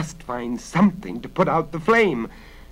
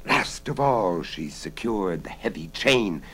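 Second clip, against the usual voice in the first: plain reading voice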